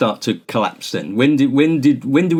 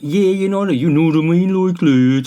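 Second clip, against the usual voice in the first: Scouse attempt sliding into Brummie; bad Scouse accent; 6 semitones higher than usual. Shown as a demonstration. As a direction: try a hammed-up Scouse voice, starting high. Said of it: "trying to do the Scouse accent".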